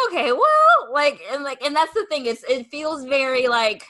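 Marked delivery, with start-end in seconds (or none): 0.1-0.9 high, louder, put-out reaction; taken aback. Retold or imitated. Rejected dancer reacting to the brush-off, taken aback. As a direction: echo being taken aback, pitched way up.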